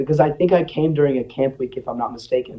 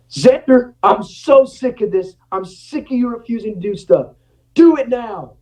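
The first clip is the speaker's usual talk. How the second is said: high, loud furious shouting; angry yell; 8 semitones higher, 3 dB louder than usual. Retold or imitated. Coach yelling at a student who refuses, furious. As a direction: yell at him, fed up.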